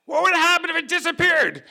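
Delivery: high, loud menacing demand; freaked-out cop yelling. Retold or imitated. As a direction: shout it like a cop gone crazy. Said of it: "he's freaking me out".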